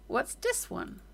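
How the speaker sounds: ordinary delivery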